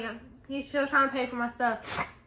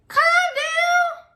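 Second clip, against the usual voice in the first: very high, loud mock yell; squealed, exaggerated protest; exasperated shout; 5 dB louder than usual. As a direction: yell it back at an irritated dad, pitched way up. Yells back at dad's attitude.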